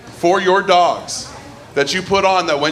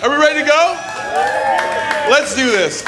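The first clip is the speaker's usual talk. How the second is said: hype shout; 9 semitones higher than usual. Real, not acted. Host hyping the crowd, shouting. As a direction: rev up the room. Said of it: "host hyping crowd".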